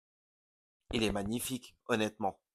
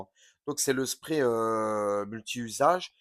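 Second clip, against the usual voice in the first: ordinary talk, nothing marked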